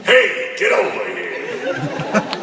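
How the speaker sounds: loud gruff barking cop, dragged out slowly; gruff detective yelling at a suspect; tough cop Bullock. Pretend. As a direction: bark it like a hard-nosed cop. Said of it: "Don't you want me to play Bullock?".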